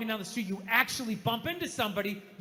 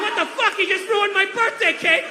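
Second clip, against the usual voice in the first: loud, high-pitched indignation; outraged protest; 10 semitones higher, 7 dB louder than usual. Acted. Baker whose cake was thrown across the floor, outraged. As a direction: yell in acted disbelief.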